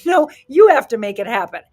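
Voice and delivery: loud, insistent correction; forceful coach correction; emphatic. Real, not acted. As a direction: shut the excuse down firmly.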